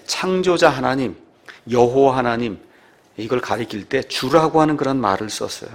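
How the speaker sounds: ordinary talk, nothing marked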